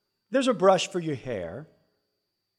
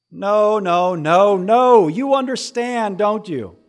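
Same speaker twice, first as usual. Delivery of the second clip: emphatic no; 6 semitones higher, 9 dB louder, slower than usual. Acted. Emphatic refusal to audience.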